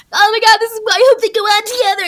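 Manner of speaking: high, loud squeal of excitement; imitating friends' thrilled gushing; giddy gossip. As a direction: squeal like a teen spotting a crush couple. Friends gossiping about a backstage couple.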